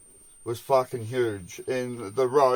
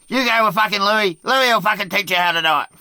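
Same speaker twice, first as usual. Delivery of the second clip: higher, louder skipper rant; mock angry scolding; 7 semitones higher, 6 dB louder than usual. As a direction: berate the kid, hammed up. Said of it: "he said to me".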